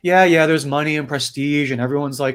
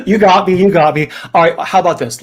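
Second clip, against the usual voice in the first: loud, higher, tickled admission of defeat; hearty, raised-voice good sport; amused concession; 4 semitones higher, 9 dB louder than usual. Acted. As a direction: concede loudly, fresh from a big laugh. Demon conceding amid laughter.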